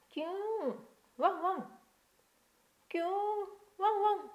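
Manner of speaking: high yelping dog sounds, whimper then woof; be the trapped dog crying out from the pit; puppy whimper and bark. Acted. Dog in the hole whimpering up to the children, high yelps.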